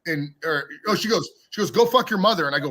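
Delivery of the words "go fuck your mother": higher, louder mock outburst; retold angry insult. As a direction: imitate a woman cussing someone out. Retold backstage quarrel, woman's insult.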